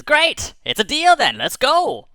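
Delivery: high, loud and eager; excited high. Acted. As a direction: jump at the deal, delighted. Bird eagerly agrees.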